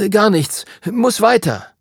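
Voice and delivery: high, hurried brush-off; flustered boy. A narrator's reading. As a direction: brush him off nervously. Lukas brushes him off.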